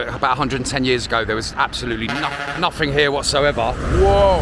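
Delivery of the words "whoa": sudden startled whoa; surprised whoa. Real, not acted. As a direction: caught off guard, loud. Sudden exclamation on the road.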